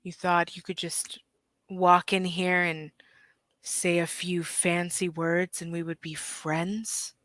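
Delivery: quiet, restrained challenge; lightly voiced boss, low-key and doubting; sceptical merman boss. Merman challenges the visitors' friendship, quiet sceptical boss voice.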